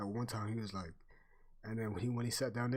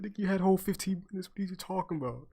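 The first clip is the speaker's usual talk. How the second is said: higher, louder and fast mock scolding; retold exasperated outburst; 8 semitones higher, 7 dB louder, faster than usual. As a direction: fire it back at him, fed up and quick. Retold scolding friend, repeated louder.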